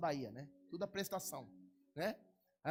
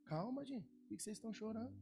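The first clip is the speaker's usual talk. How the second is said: calm reassurance; 2 semitones higher, 8 dB quieter than usual. Pretend. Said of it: "você vai dizer assim".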